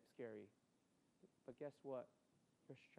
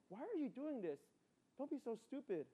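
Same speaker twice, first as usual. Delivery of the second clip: imitating mom; 7 semitones higher than usual. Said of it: "she'll start talking to the characters".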